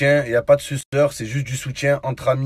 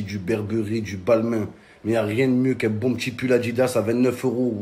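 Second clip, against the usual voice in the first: normal talking voice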